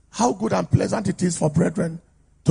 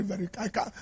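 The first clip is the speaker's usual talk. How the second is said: ordinary talk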